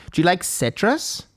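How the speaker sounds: slow, lightly voiced hostess question; a hint of a quizzing hipster bartender; hipster server. Bartender quizzes customer on tastes, hipster server. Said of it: "she goes".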